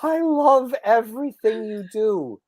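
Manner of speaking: high, slow, adoring gush; imitating a starstruck fan's heartfelt praise. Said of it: "she goes".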